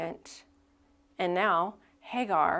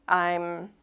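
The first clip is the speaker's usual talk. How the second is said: usual speaking voice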